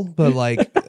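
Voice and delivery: normal talking voice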